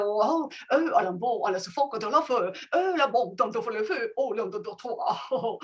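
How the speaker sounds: mock French, playful made-up words; fake French gibberish. Shown as a demonstration. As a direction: pretend to speak French. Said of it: "gibberish is like you make the sound pretending to speak another language".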